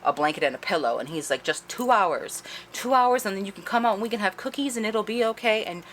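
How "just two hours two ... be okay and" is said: lightly voiced high, sing-song coaxing; mock-soothing, pitched up like talking to a child; coaxing captor. Outcast coaxing the captive, acted out.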